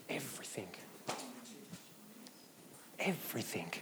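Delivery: hushed whisper for effect. Real, not acted. Preacher answering his own question to the church, whispered. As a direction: breathe the word out, voiceless.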